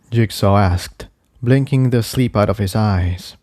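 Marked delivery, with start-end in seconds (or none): none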